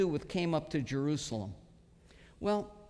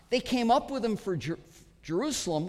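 ordinary talk

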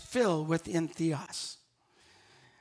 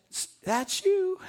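playful reply; 10 semitones higher, slower than usual. Retold or imitated. Kiosk woman sings back playfully.

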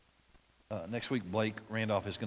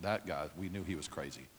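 neighbors' mock aside; 7 semitones lower, 9 dB quieter than usual. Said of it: "They probably thought".

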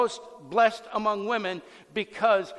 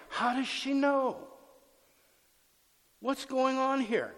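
bewildered; 5 semitones higher than usual. Retold or imitated. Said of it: "Mary sitting there going".

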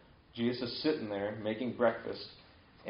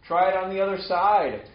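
loud, raised-pitch call across water; yelling from shore; 8 semitones higher, 8 dB louder than usual. Retold or imitated. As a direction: call out to people in a boat. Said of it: "Jesus yells out from shore".

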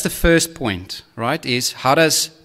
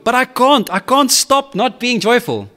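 mock whiny child; 9 semitones higher than usual. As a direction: whine like a kid who can't. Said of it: "When you say to your child".

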